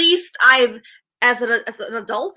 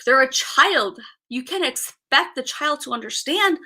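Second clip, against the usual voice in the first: ordinary talk, nothing marked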